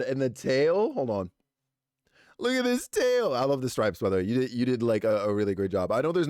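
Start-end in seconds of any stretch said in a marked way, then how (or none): 2.4-3.3 high and loud, thrilled; excited. Real, not acted. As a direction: show it off with delight. Showing off fan art.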